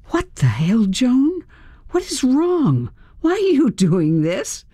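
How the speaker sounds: high, bewildered protest; alarmed. A narrator's reading. Woman to Joan who just shot at her, alarmed protest. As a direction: demand an explanation in a rising, panicked pitch. Held at gunpoint, pleading for answers.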